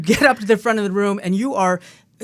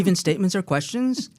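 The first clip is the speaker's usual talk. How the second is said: statement lifted into a question on "questions"; uptalk. Shown as a demonstration. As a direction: rise at the end on "questions", as if unsure. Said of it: "talk like that".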